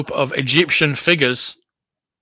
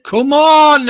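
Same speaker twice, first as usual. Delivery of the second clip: louder and higher, exasperated; impatient come on; 11 semitones higher, 12 dB louder than usual. Real, not acted. Viewer urges the slow auctioneer to hurry, loud exasperated.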